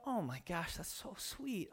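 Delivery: soft hushed aside, heart melting; tender near-whisper of a moved dad. Retold or imitated. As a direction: breathe it out softly, touched. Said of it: "And I go".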